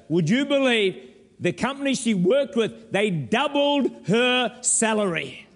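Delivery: high, loud rousing sermon voice; preaching at full force. Acted. Preacher urging the church to rejoice, loud and high. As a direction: fire up the congregation, pitched high and loud.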